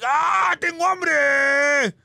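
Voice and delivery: high, slow wailing shout; mock yell. Rabbit yelling on the coaster, slow wailing shout. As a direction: wail it like a hungry rabbit on a ride. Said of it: "Conejo es así".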